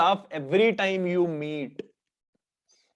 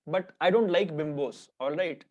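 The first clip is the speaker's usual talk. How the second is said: usual speaking voice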